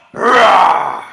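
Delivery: loud, rough mock monster roar; hulk roar. Shown as a demonstration. Coach showing kids the Hulk command: playful mock roar. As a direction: give them a big Hulk roar. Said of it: "you're gonna go".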